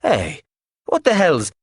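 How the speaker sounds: sharp rising protest; angry outburst. Acted. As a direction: cut off mid-shout. Man confronting, cut off.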